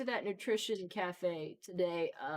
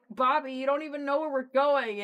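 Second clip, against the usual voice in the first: scolding call; 8 semitones higher, 8 dB louder than usual. Retold or imitated. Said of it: "And I'm like".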